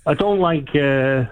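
caller hamming up a bad Cockney accent; a fake Cockney caller, poorly done. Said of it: "your Cockney accent is as bad".

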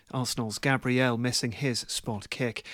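ordinary delivery

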